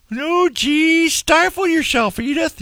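high, put-on Archie Bunker voice. Shown as a demonstration. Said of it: "You don't even have to say it like that".

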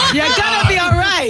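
high-pitched, laughing through the words; laughing reassurance. Real, not acted. Survivor lightening her grim story for listeners, laughing reassurance. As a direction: reassure them while cracking up, voice way up.

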